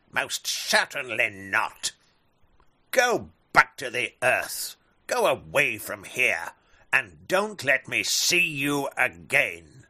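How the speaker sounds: loud, stern eagle command; angry eagle. Acted. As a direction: banish him loudly. Eagle banishes bat.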